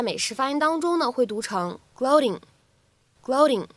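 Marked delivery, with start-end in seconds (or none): 2.0-3.7 "gloating" with the t as a quick tap; American flap t. Shown as a demonstration. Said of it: "做一个典型的闪音的处理". (“gloating”中的t读成美式闪音)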